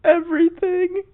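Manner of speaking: very high, slow imitation whine; mock crying. Imitating the crying contestant answering the host, mock tearful. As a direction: wail it thinly, hammed-up. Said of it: "she's just crying and she goes".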